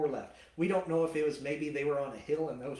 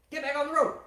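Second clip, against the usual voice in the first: mock angry yell; 11 semitones higher, 6 dB louder, faster than usual. Balaam yelling at his donkey.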